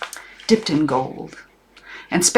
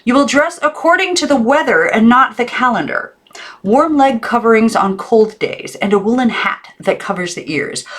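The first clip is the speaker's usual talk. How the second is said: high, loud dress code decree; stern aunt; 7 semitones higher, 4 dB louder than usual. A narrator's reading. Aunt dictating rules to Maggie, high and stern. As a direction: list the rules like orders. Aunt voiced in read story.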